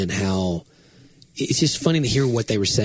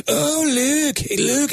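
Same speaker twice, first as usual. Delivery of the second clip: high mock-Scottish "look"; imitated Scottish accent; much slower than usual. Host imitating comedian's accent lesson, high Scottish accent. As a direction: mimic an exaggerated Scots "look". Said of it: "you say look".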